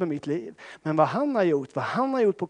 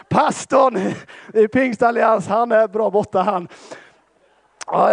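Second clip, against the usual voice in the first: loud, high, full-force preaching of a funny story; animated preaching; 6 semitones higher, 7 dB louder than usual. Acted. Pastor tells the church his race blunder, loud and lively.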